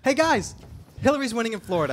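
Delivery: loud, high excited call. Real, not acted. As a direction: announce the good news thrilled. Excited election night news.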